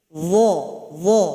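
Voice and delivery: "Dha" with tongue lightly bitten, thick voiced th; dha biting tongue. Shown as a demonstration. Teacher demonstrating dha to students, tongue bitten. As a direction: bite the tongue tip and say "dha" heavy. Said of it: "Taruh lidah di ujung sini".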